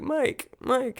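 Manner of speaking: high, pleading mock cry; imitated crying. Elle calls after Mike, mock tearful. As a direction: call the name in an imitated tearful plea. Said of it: "she's, like, crying and stuff".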